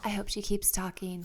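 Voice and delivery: soft whisper back; quiet, unvoiced reply; hushed aside. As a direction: whisper it secretly to your co-host. Hushed aside as guest comes.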